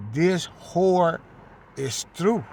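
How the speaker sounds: loud, high preaching cry; booming sermon proclamation; preaching at full force. Acted. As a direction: cry it out like a street prophet.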